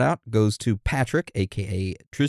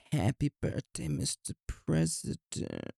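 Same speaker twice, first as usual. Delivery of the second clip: Marilyn Monroe style; 3 semitones higher, 11 dB quieter than usual. Shown as a demonstration. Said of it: "The Marilyn Monroe style is the".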